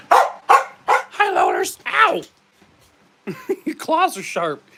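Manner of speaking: high loud squeal to the pets; squeaky pet talk. Pretend. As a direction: squeak at them playfully. Baby voice to pets, hurt.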